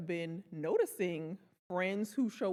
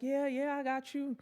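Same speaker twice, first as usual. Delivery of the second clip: glossing over; 7 semitones higher than usual. Retold or imitated. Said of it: "Somebody's like".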